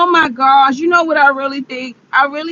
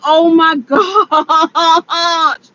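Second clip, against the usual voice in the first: loud, long wobbling cry of dismay; real distressed wail; 2 semitones higher, 5 dB louder than usual. Real, not acted. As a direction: wail it out, dragging the last word. Wailing over the catfish.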